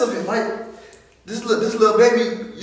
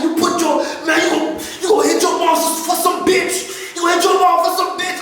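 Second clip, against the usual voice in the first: angry brother; 9 semitones higher than usual. Retold or imitated. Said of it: "he came through like".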